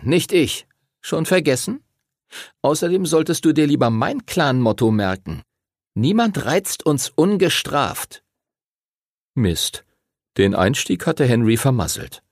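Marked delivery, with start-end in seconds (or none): 0.0-8.2 put-on a smug rival boy warning; Timothy's voice. A narrator's reading. Timothy warns Henry with his own clan motto, cocky.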